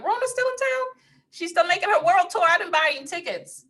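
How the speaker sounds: high sassy put-on voice; high mocking voice, playful; mock sassy quote. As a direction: pitch it way up, teasing. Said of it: "I'm like".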